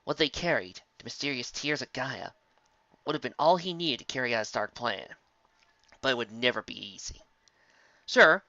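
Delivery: ordinary delivery, nothing marked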